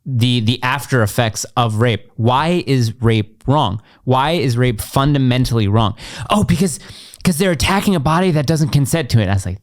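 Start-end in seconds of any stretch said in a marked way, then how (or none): 2.2-6.0 slow, each word weighed; patient but firm; slow pointed question. Shown as a demonstration. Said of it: "I'm saying". 6.3-9.3 mock critic answer. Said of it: "they're like".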